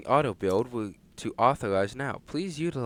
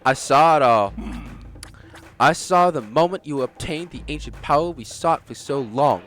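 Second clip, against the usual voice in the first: excited; 4 semitones higher, 8 dB louder than usual. Acted. Loud excited game character.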